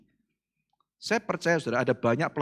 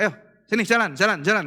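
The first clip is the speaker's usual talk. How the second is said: louder, higher urging to walk; urging command; 7 semitones higher, 6 dB louder than usual. Retold or imitated. Preacher urging lame student to walk, loud command. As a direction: push the boy to walk, loud.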